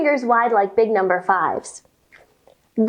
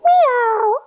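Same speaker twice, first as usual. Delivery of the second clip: cat meow; 11 semitones higher than usual. Shown as a demonstration. Teacher showing kids the cat sound, high meow. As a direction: mew like a cat. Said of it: "cat pose".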